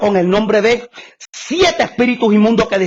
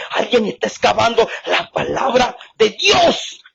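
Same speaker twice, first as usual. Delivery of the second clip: ordinary talk, nothing marked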